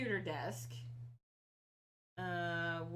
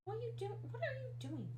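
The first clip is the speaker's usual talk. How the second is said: high, quick cooing question; asking the sniffing cat in a high pet voice; pet talk; 11 semitones higher, 5 dB quieter, faster than usual. Real, not acted. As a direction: chide the cat playfully, pitched up and fast. Talking to the cat.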